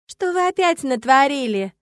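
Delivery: stretched-out, reproachful question; exasperated demand. A narrator's reading. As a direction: demand what they have done. Angry secretary scolds the students.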